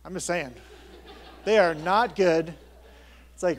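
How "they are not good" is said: loud, slow "They are not good"; "not good" drawn out and loud, for laughs; emphatic repeat. Real, not acted. Comic rant, repeats the verdict.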